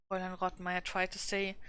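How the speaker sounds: plain reading voice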